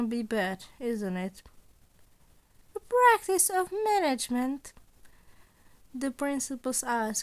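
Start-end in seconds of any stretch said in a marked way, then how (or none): 2.7-4.7 higher, playful voice for the scheming principal; sly, amused old mentor toying with his student; Nezu voice. Acted. Principal Nezu teasing Izuku about the job, higher sly voice.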